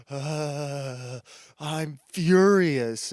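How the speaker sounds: hammed-up seething, stretching the words; mock angry leftist. Host reenacting left-wing video ranters, mock fury. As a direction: gasp and groan into slow, drawn-out fury. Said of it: "maybe I could just reenact it".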